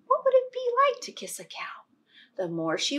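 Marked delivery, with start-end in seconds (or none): 0.1-1.8 much higher, quick curious voice; girl wondering to herself. Acted. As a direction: muse aloud in a little girl's voice.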